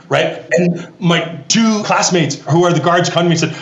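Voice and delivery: usual speaking voice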